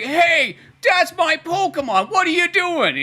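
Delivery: put-on high, slow, loud whiny kid protest; indignant kid. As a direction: play the outraged little kid. Kid angrily claims his Pokemon.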